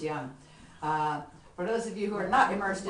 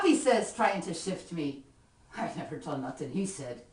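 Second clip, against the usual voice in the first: lively Irish ballad recitation, rhythmic; poem recitation; 3 semitones higher, 6 dB louder than usual. Acted. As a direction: perform the comic poem with swing.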